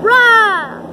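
loud playful roar to scare; startling boo. Pretend. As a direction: jump out with a big rah. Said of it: "Did I scare you guys?".

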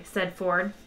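ordinary delivery

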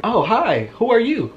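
high, sing-song mom voice; a surprised mother, pitched well up; high-voiced mock mom greeting; imitating his mom. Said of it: "And she's like".

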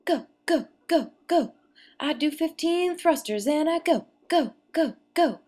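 rapid urgent go go go; excited. Real, not acted. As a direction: chant to keep going. Pushing herself through workout.

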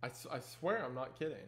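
quietly spooked, insisting it was real; hushed and shaken. Real, not acted. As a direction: talk low like someone might be listening at the door. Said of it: "I am wigged out".

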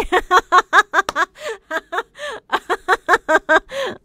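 long high giggling fit; real laughter that climbs up high. Real, not acted. Laughing on air at a listener's joke about her photo, long high giggle.

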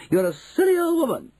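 shrill outrage; indignant insult. Acted. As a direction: fling the insult in a piercing high voice. Mrs Jenkins insults grandmother.

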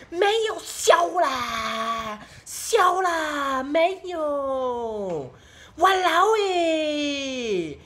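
high, very drawn-out Singaporean Chinese whine; loud, hammed-up Singaporean Chinese accent, stretching each ending. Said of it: "the Singaporean Chinese one is like".

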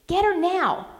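high, loud, gruff sailor's command; old sailor barking fierce orders in a storm. A narrator's reading. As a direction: order her with fierce urgency. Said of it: "he ordered with a fierceness".

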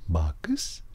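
hushed breathy name; whispered call. Retold or imitated. Host whispers the film character's name.

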